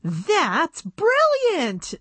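high, drawn-out delighted exclamation; excited exclamation. A narrator's reading. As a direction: stretch it out high with admiration. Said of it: "exclaimed Tiffany".

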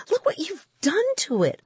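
put-on a boy crying out at his brother; upset cry. A narrator's reading. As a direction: wail it at the ruined cape. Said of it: "I cried".

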